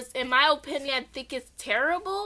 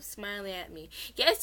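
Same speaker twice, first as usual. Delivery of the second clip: plain reading voice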